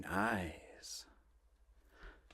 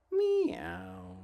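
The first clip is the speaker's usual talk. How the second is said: very high mewing meow; a little kitten's cry; squeaky drawn meow. Acted. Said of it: "cats and kittens say".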